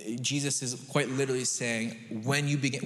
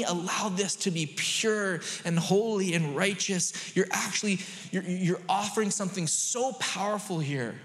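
raised, impassioned sermon voice; fervent preaching; 5 semitones higher than usual. Real, not acted. Preacher urging congregation to recognize God's holiness, fervent preaching. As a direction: build with driving conviction, punching the key words.

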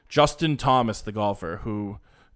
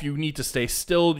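ordinary talk

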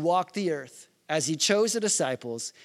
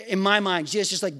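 normal talking voice

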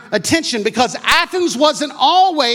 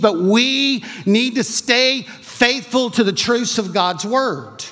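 ordinary talk

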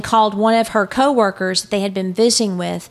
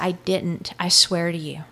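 quiet pleading; 3 semitones lower, 5 dB quieter than usual. Retold or imitated. Retold pleas of strangled victim.

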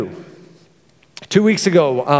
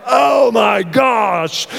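slow, stretched "oh my gosh" with a shout; startled alarm; 3 semitones higher, much slower than usual. Retold or imitated. Dad spotting the snake at his feet, slow shocked shout.

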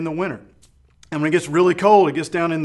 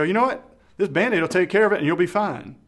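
mock breezy brush-off, higher, louder and fast; dismissive brush-off; 4 semitones higher, 5 dB louder, faster than usual. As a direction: rattle it off like a quick fix that ends the talk. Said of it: "we don't look past that and go".

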